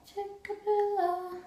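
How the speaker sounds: very high, soft and drawn-out Tickabilla; silly, slow, quiet squeal of a name; playful high voice. Pretend. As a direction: stretch Tickabilla out in a tiny high-pitched voice. Playfully repeats the show title.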